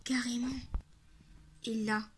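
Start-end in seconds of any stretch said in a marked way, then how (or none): none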